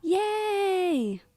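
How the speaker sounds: high, drawn-out happy yay; whole-hearted storybook cheer; class cheering. A narrator's reading. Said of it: "The class cheers".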